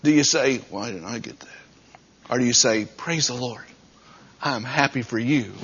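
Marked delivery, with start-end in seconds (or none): 0.7-1.5 low, very soft grumble; envious mutter. Acted. Churchgoer to himself when someone else prospers, envious mutter. Acted envious reaction to another's good fortune. 2.8-5.5 gracious well-wishing. Acted. Acted gracious reply, contrasted with envy.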